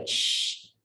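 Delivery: long unvoiced shh breath; breathy tafashi hiss; airy hiss. Shown as a demonstration. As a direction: push the air out in a hiss. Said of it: "sifat berhemburan".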